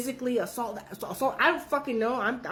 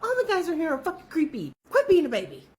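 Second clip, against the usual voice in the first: scolding friend; 8 semitones higher than usual. Retold or imitated. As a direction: tell her off with a laugh. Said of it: "I'm like".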